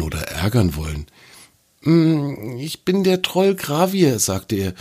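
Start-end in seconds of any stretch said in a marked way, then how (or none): 1.8-4.2 lightly higher, embarrassed troll voice; an abashed little troll introducing himself; a hint of a shy troll. A narrator's reading.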